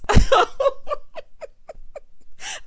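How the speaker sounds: long, high, loud cackle; real laugh. Real, not acted. Host loving Ryan's dry humor, hollering with laughter.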